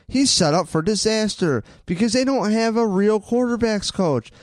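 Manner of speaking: ordinary talk, nothing marked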